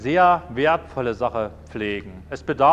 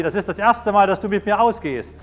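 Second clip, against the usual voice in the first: high, raised punchline delivery; mock joyful wife; 6 semitones higher, 5 dB louder than usual. Wife to husband in the tornado, loud mock delight. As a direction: call it out high, playfully triumphant.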